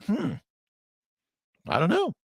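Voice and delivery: high, light, wondering voice; mock considering an idea; open-minded musing. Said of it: "Rather than going like".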